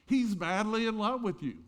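high, loud, jubilant declaration; emphatic proclamation. Real, not acted. Preacher assuring congregation of God's love, loud and exultant. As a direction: ring it out to the whole congregation.

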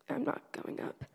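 put-on soft, breathy whisper of a shy kid; whispered refusal. Junior high kids refusing the altar call, sheepish whisper. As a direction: hush it like nobody should hear.

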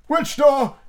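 high, loud creaky frog voice; lightly voiced old frog; old frog answering Alice. A narrator's reading. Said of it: "the slow drawl".

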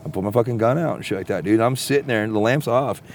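usual speaking voice

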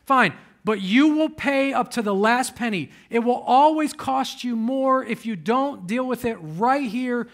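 loud, high preaching build; full-force preaching. Acted. Preacher urging church to reconcile, loud preaching. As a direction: drive it home to the congregation.

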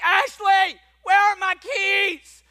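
high, drawn-out frantic holler; freak-out yell. Acted. Husband yelling to his wife for lost keys, freaking out. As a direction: bellow it in an exaggerated freak-out. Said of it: "I go into freak out mode".

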